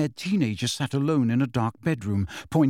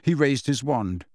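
usual speaking voice

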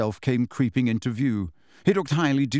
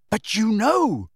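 shout; 8 semitones higher, slower than usual. Acted. Harry shouting at the elf, accusing shout. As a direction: accuse him outright. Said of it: "shouted Harry".

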